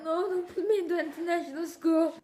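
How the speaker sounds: put-on high whiny kid, half-laughing; a hint of a wailing child; mock crying child. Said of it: "I was crying like hell".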